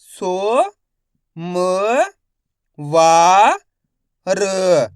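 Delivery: सो, म, वा, र each held, pauses between; syllable-by-syllable reading. Shown as a demonstration. Teacher modelling for learners, सो म वा र one syllable at a time. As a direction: sound out सो... म... वा... र separately. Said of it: "एक एक अक्षर का उच्चारण करके".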